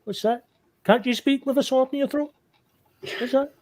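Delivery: high-pitched mocking questions; mocking taunt. Player taunting a guard with a sword in his throat, high mocking. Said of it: "start mocking him, saying".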